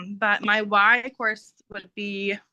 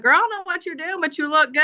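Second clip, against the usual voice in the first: higher, playful voice of an admiring patient; sassy patient; 8 semitones higher, faster than usual. Retold or imitated. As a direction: hype her up like a cheeky patient. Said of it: "one of our patients at work was like".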